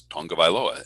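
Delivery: Tonga Vailoa with an ng before the g; added ng sound. Shown as a demonstration. Announcer explaining the name, Tonga Vailoa with ng added. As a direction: put the unwritten ng into Tonga Vailoa. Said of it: "always add the ng sound to the G".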